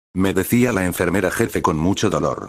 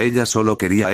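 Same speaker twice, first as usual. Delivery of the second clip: usual speaking voice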